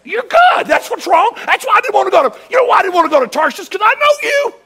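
high, loud angry shouting; furious shout. Retold or imitated. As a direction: hurl it at God in a rage. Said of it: "He's really mad at God".

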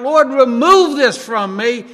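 slow, loud pleading to the Lord; crying out. Retold or imitated. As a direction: beg slowly for relief. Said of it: "you're crying out".

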